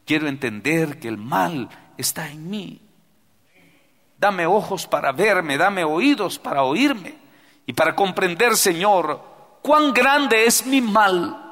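rising fervent plea; pleading prayer. Acted. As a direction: beg the Lord for ears to hear.

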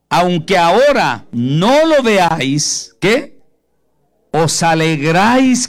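loud, high, slow preaching of the verse; drawn-out pulpit cry; fervent preaching. Acted. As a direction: proclaim each phrase to the congregation. Preaching the verse at full force.

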